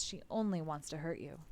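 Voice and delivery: usual speaking voice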